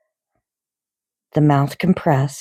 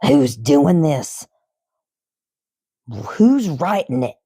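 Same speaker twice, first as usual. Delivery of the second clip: slow, understated demand; half-hearted angry shout; mild yell; slower than usual. Acted. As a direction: Trunchbull yelling at the room. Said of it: "she yelled at nobody".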